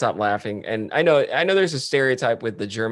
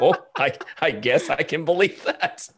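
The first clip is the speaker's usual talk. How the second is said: higher, slow words broken by giggles; laughing; 6 semitones higher than usual. Real, not acted. Listener reacting to a TikTok joke, real laughter. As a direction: crack up while getting the words out. Cracking up at own retold joke.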